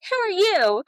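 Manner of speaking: high-pitched imitation of a small child; little girl's voice. Daughter meets mom's new man, high child voice. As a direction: pipe up like a curious little kid. Voicing her young daughter meeting him.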